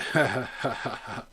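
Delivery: a short burst of real laughter; quick genuine chuckle. Real, not acted. Laughs at own joke.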